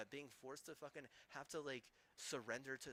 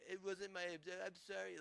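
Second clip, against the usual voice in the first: mock whiny apology; 5 semitones higher, 4 dB louder than usual.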